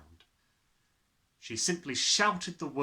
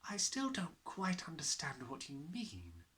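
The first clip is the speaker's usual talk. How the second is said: softer, gentle teacher; gently; 4 semitones higher, 7 dB quieter than usual. A narrator's reading. Miss Honey gently questioning Matilda, softer. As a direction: ease her along softly. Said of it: "Miss Honey said gently".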